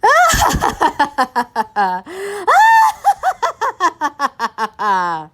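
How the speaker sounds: loud wild cackle from the basket; wild laughter. A narrator's reading. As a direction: cackle wildly, loud and eerie. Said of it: "followed by wild laughter".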